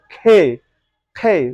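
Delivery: "খে" with aspirated kh; American and British pronunciation. Shown as a demonstration. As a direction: say "খে" with a strong puff of air. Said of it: "এভাবে উচ্চারণ করে থাকে".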